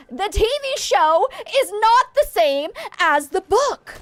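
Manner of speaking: loud, high, slow outburst; raging. Real, not acted. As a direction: rant it out, drawn and loud. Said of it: "I'm sorry I'm raging right now".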